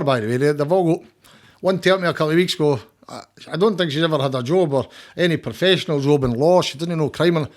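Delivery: ordinary talk, nothing marked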